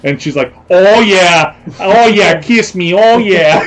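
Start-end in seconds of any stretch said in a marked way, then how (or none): 0.7-2.4 put-on high, loud parody Boston accent; mock Boston woman. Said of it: "a woman from Boston". 2.4-3.6 put-on high, loud parody Boston accent; mock Boston woman. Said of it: "a woman from Boston".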